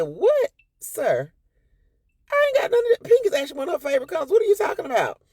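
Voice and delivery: high, loud outraged comeback; indignant retort. Retold or imitated. Wife shouting back at husband over the shoes, outraged. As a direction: shriek it back in disbelief.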